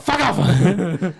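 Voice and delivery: high, loud retold shout; re-enacted angry holler; shouted dismissal. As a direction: yell it at a rowdy group. Retold yell at group of blokes.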